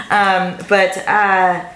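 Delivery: usual speaking voice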